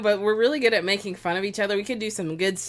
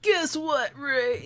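"Guess what" jumps much higher in playful mockery; mocking singsong; 8 semitones higher, much slower than usual. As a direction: tease him by parroting his opener way up high. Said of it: "I'll be like".

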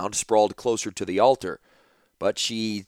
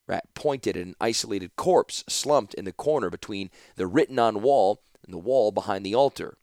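ordinary delivery, nothing marked

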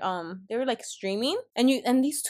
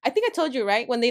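ordinary talk, nothing marked

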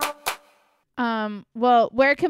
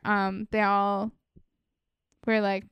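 ordinary delivery

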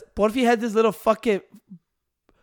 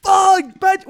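high, loud startled yell; excited shout; 11 semitones higher, 6 dB louder, slower than usual. Retold or imitated. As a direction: imitate a kid amazed by a card pull. Imitating excited cousin.

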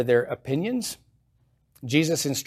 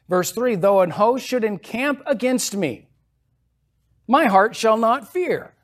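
higher and louder, building triumph; rising preaching; 8 semitones higher, 6 dB louder than usual. Acted. Preacher proclaiming Psalm to the congregation, rising fervor. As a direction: lift it to a triumphant peak.

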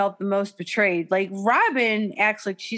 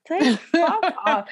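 laughing fuck off; 8 semitones higher than usual. Real, not acted. Mock reaction to imagined apology.

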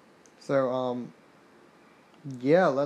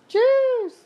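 1 squeaky jeez; 6 dB louder than usual. Real, not acted. Reacting to stacked rival.

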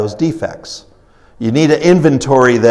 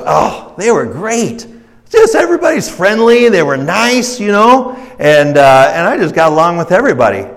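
higher-pitched, friendly gushing; enthusiastic traveler; 6 semitones higher than usual. Acted. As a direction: play a delighted traveler singing a town's praises. Happy traveler praises his last town.

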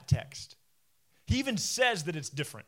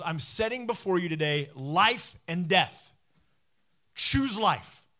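slow, emphatic challenge, rising to a loud finish; solemn charge; 5 dB louder, slower than usual. Retold or imitated. Preacher quoting Moses's charge to Israel, solemn and emphatic.

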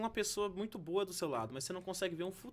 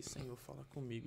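softly murmured plea; a hushed, urgent prayer under the breath; quiet prayer; 5 semitones lower, 10 dB quieter than usual. Retold or imitated. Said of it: "tava bem baixinho". (oração baixinha, quase sussurrada)

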